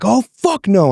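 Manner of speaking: sharp high mock yell, half-acted; retold yell. Retelling fury at glove switch, mild mock yell. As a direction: flare up as if yelling again. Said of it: "And I start yelling".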